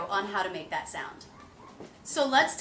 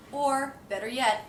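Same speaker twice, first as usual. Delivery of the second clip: ordinary delivery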